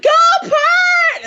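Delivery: high, loud imitated cheer; mock crowd shout. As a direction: yell it like a cheering fan.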